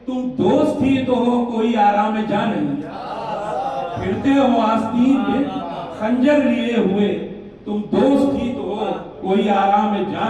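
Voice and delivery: projected, rhythmic mushaira delivery; emphatic Urdu verse with deliberate pauses; ghazal declamation. Acted. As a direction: throw each couplet out to the audience. Declaims ghazal couplets to audience.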